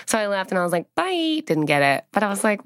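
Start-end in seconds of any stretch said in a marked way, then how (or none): 0.9-1.4 awkward bye. Retold or imitated. Acted awkward exit from audition.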